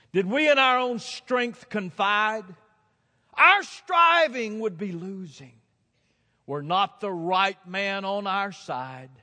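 slow, high, loud recitation; declaimed hymn verse. Retold or imitated. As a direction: proclaim each phrase slowly and forcefully. Recites hymn verse at full force.

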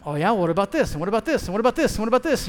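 mounting eager questioning; eager barrage of questions. Retold or imitated. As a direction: pepper him with questions, building. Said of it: "it's question time".

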